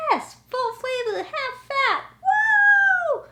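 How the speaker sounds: high, loud gleeful whoop; excited cheer. Retold or imitated. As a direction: cheer like you won a prize. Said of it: "I was like".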